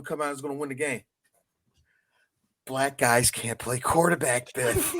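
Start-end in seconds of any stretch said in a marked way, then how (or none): 2.7-4.9 mock George McFly.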